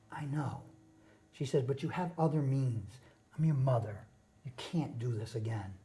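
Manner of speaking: hushed, low retelling of a hurt mother; mild, soft imitation of mom pleading; mother's tearful words. Mother begs her son from the car window, hushed and soft. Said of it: "She started crying".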